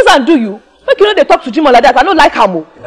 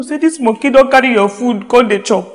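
normal talking voice

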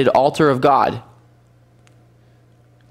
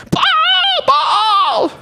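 desperate shout. Acted. Priests of Baal shouting to their god, very high. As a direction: scream the idol's name in desperation.

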